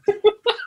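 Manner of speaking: short high-pitched laugh. Real, not acted. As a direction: burst out laughing. Laughs at Siri interrupting.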